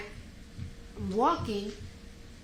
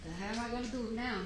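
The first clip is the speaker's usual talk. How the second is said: 1 inner thought; 7 dB quieter, faster than usual. Retold or imitated. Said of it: "I'm thinking to myself".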